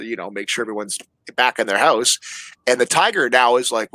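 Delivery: normal talking voice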